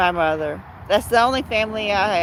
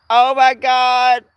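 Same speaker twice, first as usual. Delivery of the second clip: louder, slow, drawn-out "Oh my God"; overwhelmed; 3 semitones higher, 8 dB louder, slower than usual. Retold or imitated. Reacting to the thoughtful gift, loud and slow. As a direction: let it swell with being touched. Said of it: "I was like".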